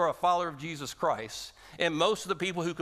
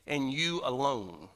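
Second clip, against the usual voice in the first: "you" pressed slow and deliberate in "and you alone"; stress on you alone; 3 semitones lower, slower than usual. Real, not acted. Preacher to congregation, slow emphatic stress on "you alone". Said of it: "it's emphatically stated".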